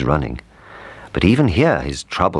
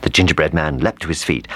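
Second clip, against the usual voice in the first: plain reading voice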